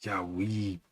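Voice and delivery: slow, put-on Glaswegian accent; attempted Scottish accent. Streamer throwing in Scottish words, put-on Scottish accent. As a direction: draw it out in amateur Scots. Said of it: "Glaswegian words in there".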